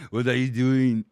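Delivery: hammed-up action-hero impression; Arnold Schwarzenegger. Showing what dad impression became, hammed-up strongman voice. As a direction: push it out like an Austrian strongman. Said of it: "I guess, my Arnold Schwarzenegger".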